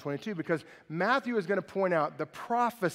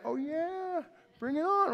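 high sneering taunt, much higher; jeering trash talk pitched way up; mock smack talk; 11 semitones higher than usual. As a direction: throw down a cocky dare in a high voice. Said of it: "have you ever talked smack? You're like".

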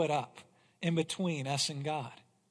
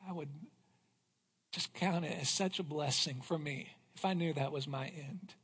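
soft, lower, heartfelt; tender; 5 semitones lower, 8 dB quieter than usual. Real, not acted. Husband speaking tenderly of his wife. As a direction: speak of her gently, moved.

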